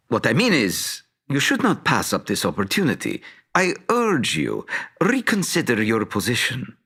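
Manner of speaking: ordinary delivery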